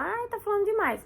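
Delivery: high-pitched whine of an impatient viewer; a hint of a fed-up viewer; mock whiny complaint. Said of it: "aí a pessoa fala".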